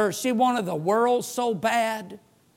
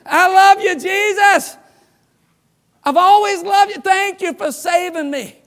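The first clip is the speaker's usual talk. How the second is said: high, loud, hammed-up adoration with a laugh; mock worship; 9 semitones higher, 7 dB louder than usual. Pastor kneeling to mock the sinless visitor, hammed-up gushing. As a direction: gush over him like a fake worshipper. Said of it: "I started to kneel".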